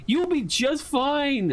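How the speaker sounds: high, slow, anxious reassurance; pleading with it not to move; nervous pleading. Pretend. Streamer begging the twitching squid, high nervous reassurance. Soothing again, more nervous.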